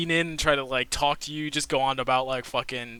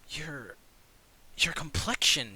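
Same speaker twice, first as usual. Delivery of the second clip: hushed admiration; 4 dB quieter than usual. Acted. Tilda admires elf's skin.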